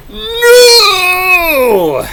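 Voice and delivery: high, loud cartoon cry; mock cry. Vlogger reading the comic's scream, high and loud. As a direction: yell it like a panel scream. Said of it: "We're not gonna peek the mics".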